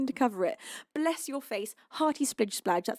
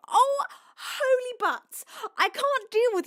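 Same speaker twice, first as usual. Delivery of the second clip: flustered squeal; 12 semitones higher, 4 dB louder than usual. Real, not acted. Overwhelmed by gifts.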